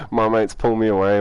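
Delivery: ordinary talk, nothing marked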